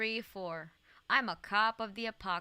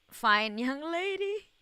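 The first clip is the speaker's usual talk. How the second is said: slow, higher, louder mock-suave; hammed-up flirt; 7 semitones higher, 7 dB louder, slower than usual. Streamer asking imps about a game blonde, slow mock-seductive. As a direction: drag it out like a cheesy flirt.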